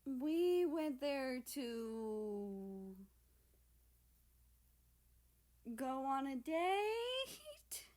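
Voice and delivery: put-on high, very slow, shy girl voice; a shy voiced answer; embarrassed girl.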